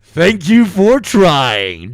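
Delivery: very high, loud, gloating shouts; triumphant whoop. Real, not acted. Streamer celebrating winning the gamble, gloating shout. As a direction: cheer like you won big.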